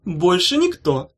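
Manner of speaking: high slow polite answer; politely. A narrator's reading. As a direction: answer sweetly, all innocence. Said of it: "вежливо сказала Улита".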